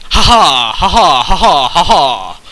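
loud hammed-up evil laugh; loud fake evil laughter; mock villain laugh. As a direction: cackle like a cartoon villain. Villain laugh in agar.io-like game.

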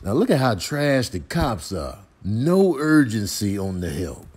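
ordinary talk, nothing marked

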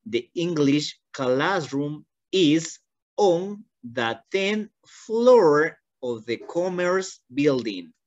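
very slow, every word spaced out; slowly, so the student can follow; slow model reading. Shown as a demonstration. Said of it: "voy a leer una vez más". (lectura muy lenta y pausada)

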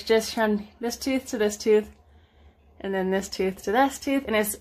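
talking through clenched teeth; finger pulling lip aside. Real, not acted. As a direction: lips stretched by finger. Seen 0: Fingertip hooks lip corner, baring clenched braced teeth while speaking.

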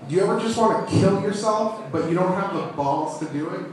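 normal talking voice

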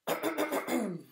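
nervous little throat clear, high and loud. Acted. Squirrel nervously clears his throat before the dragon. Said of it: "cleared his throat".

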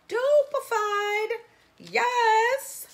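high and loud delight; excited. Real, not acted. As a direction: gush over the earrings. Excited about earrings.

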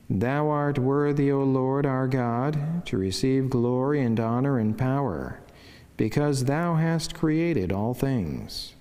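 ordinary talk

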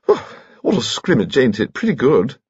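chatty, piping Victorian gentleman; high-pitched old lord. Acted. Lord chatting to painter in crowded gallery, high-pitched.

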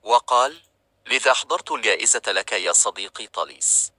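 ordinary delivery

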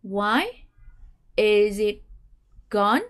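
slow "Why is it gone?" with pauses, rising on "gone"; staircase intonation. Shown as a demonstration. Teacher models the practice question. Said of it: "Once again".

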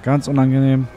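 normal talking voice

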